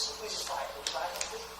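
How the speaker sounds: very soft, small plea; begging. Acted. Recruit begging a friend to buy, pleading. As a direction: beg under your breath, hammed-up. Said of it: "I'm, you know, begging him".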